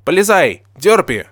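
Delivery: loud, high command; exclaiming. A narrator's reading. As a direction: order Derpy in with a shout. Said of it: "воскликнул я".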